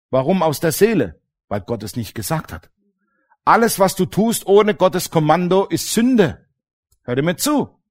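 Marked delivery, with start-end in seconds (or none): none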